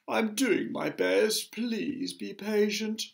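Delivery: high, slow pleading; poor glowworm. A narrator's reading. Glowworm begging the others for patience, high and plaintive. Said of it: "answered the poor glowworm".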